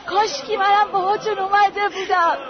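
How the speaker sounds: crying, begging to be taken; grieving daughter sobbing over coffins; sobbing plea. Acted. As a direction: weep the words out. Girl weeps over parents' coffins.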